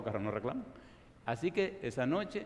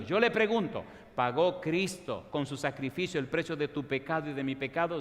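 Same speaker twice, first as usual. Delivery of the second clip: ordinary talk, nothing marked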